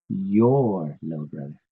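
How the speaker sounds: "your" drawn out, then "little brother" falls away; stress on YOUR. Shown as a demonstration. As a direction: lean long on the word "your". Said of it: "not mine".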